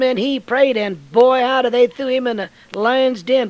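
loud, high, fiery preaching of David's sling; preaching at full force. Acted. Preacher to the congregation about Goliath, fiery and loud. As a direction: belt the story out like a revival preacher.

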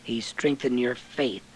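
ordinary talk

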